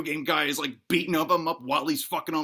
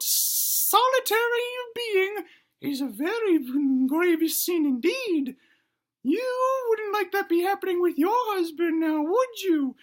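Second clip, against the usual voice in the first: sermonizing granny. Acted. Granny preaches to the wife about sin, sternly moralizing. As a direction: draw out the grave words in granny voice.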